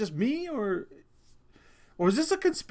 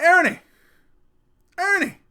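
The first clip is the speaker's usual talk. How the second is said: loud, high shout of a name; calling out; 12 semitones higher, 11 dB louder than usual. Retold or imitated. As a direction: act out calling to get someone's attention, pitched way up. Calls to toy in retold dream.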